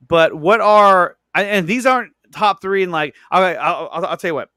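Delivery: ordinary delivery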